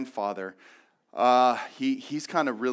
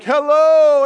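hammed-up grandpa greeting; 11 semitones higher, 16 dB louder than usual.

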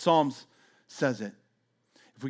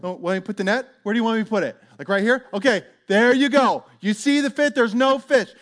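higher, louder mocking fisherman; sarcastic shout; 6 semitones higher, 8 dB louder than usual. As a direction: jeer that there's no fish. Simon throwing net, sarcastic.

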